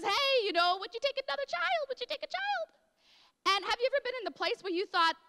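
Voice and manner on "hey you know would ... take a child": much higher, lightly voiced phone caller asking. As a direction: imitate the caller pleading gently, higher. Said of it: "And the phone rings and says".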